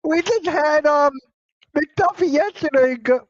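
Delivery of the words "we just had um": normal talking voice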